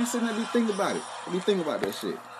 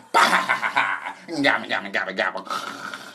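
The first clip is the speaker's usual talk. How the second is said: loud gibbering cackle; mock witch gabble; mocking her laugh; 5 semitones lower, 3 dB louder than usual. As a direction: imitate her sleep babble. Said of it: "you gonna sit here and say".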